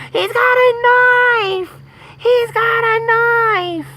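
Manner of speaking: very high, loud, slow mock scream; screaming customers. Imitating the panicked customers screaming in the store. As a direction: shriek it like a panicked crowd. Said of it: "yelled, screaming".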